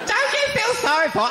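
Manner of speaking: squeaky high-pitched plea; high falsetto. Acted. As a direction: pipe it up shrill and loud. Mock pleading to audience.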